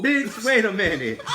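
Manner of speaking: startled laughing yell; laughing shout. Real, not acted. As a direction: blurt it out through a laugh.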